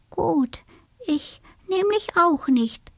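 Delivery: small high voice, a little hushed; high little mouse voice. A narrator's reading. The mouse reassures her friend, small and high. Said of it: "sagte die Haselmaus".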